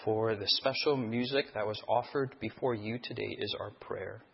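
soft, low pastor's prayer; hushed prayer before the sermon. Acted. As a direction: pray it quietly and reverently. Opening prayer.

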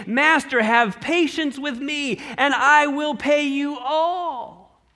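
high, slow begging servant; groveling plea. Acted. Servant begging his master, groveling and pleading. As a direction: plead on your knees, drawn out. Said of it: "He's groveling at the feet".

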